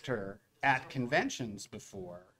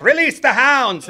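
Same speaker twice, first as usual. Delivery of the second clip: big, high shouted call to release the crowd; mock shouted command; 11 semitones higher, 13 dB louder than usual. Event staff lets the waiting fans in, a hammed-up high shout. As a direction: bellow it out like opening the gates.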